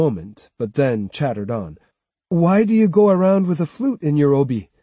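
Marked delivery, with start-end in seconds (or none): 2.3-4.7 put-on raised, eager boy asking; nosy kid's question; curious boy voice. A narrator's reading. Jotaro questions Otsu.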